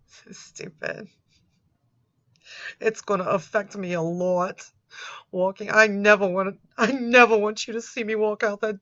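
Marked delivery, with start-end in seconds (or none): none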